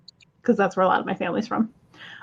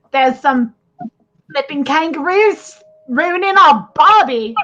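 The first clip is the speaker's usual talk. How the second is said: hammed-up Aussie, higher and louder; loud, playful Australian accent; 4 semitones higher, 5 dB louder than usual. Joking to the chat about kangaroos, hammed-up Australian accent. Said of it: "My Australian word".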